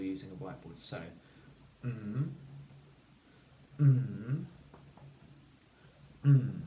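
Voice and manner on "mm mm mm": drawn-out sound. Shown as a demonstration. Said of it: "say the sound".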